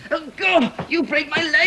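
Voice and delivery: high, strained yelps of pain; pained, high pleading under attack; crying out in pain. Acted. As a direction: cry out as your leg is twisted. Arm twisted in a fight.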